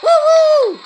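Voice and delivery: very high, loud whoop; loud celebratory woo-hoo; cheer. Real, not acted. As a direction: whoop for the winner. Celebrating spinner winner.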